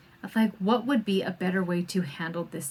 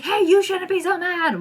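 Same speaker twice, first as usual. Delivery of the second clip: higher, louder mock scolding; mock angry parent; 9 semitones higher, 4 dB louder than usual. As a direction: ham up the mad parent yelling at the kid. Mocking a parent freaking out.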